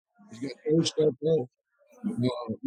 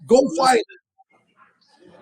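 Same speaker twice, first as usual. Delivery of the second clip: high, very slow, louder barked order; Joe Watts ordering; 11 semitones higher, 6 dB louder, much slower than usual. Retold or imitated. Captain ordering him to fetch the late boss, heated. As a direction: stretch each word out as a heated command. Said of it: "He goes".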